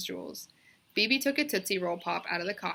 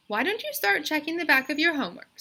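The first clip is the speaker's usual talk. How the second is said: teacher suggesting; 8 semitones higher, 5 dB louder than usual. A narrator's reading. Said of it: "suggested Missus Jules".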